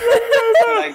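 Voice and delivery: high, slow, laughing. Acted. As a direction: squeak it through laughs.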